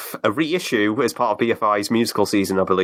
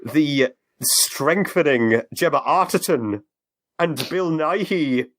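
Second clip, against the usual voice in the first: slow, booming movie trailer voice; epic announcer voice; 6 semitones higher, slower than usual. Pretend. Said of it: "in an epic announcer voice".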